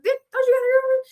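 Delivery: high, fast surprised blurt; quick high-pitched mock-shocked question; retold blurt. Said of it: "that was my first question".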